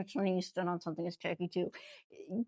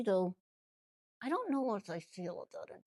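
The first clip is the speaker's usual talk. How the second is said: normal talking voice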